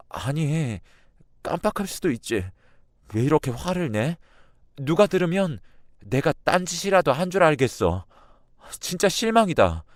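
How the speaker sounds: high-pitched, hurt wife on the verge of tears; tearful. A narrator's reading. Wife defends forgetting to her angry husband, tearful. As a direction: protest in a high, wounded, tearful voice. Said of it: "아내는 울먹이면서".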